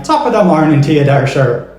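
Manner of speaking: a stage Irish greeting; Irish accent. Shown as a demonstration. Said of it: "Ireland ... They will say".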